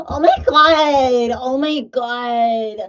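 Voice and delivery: high, loud, slow mock "Oh my God"; mock excited gasp. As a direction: squeal it out like an overexcited friend. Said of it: "Then it goes".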